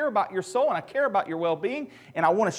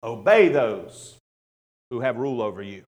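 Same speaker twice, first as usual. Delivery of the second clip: harsh commanding inflection; 8 semitones lower, 7 dB louder than usual. Retold or imitated. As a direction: read it like a stern order. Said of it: "hear the inflection of".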